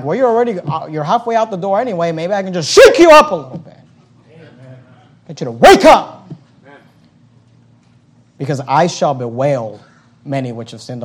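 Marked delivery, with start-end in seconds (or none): 0.0-6.3 loud, high wake-up shout; shake-up call. Retold or imitated. Pastor voicing his thoughts to a drifting member, loud wake-up shout. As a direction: shout to rouse the backslider. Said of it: "I'm thinking like".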